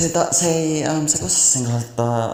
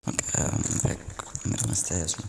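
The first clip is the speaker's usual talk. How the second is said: ASMR whisper; 7 semitones lower, 9 dB quieter than usual. Shown as a demonstration. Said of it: "mä teen A S M R-videon".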